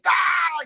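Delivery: sudden high mock scream; long, high-pitched pretend yell in someone's face; imitated gatekeeper yell. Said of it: "he yells in your face".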